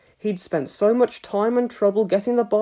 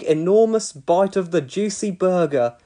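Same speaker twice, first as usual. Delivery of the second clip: usual speaking voice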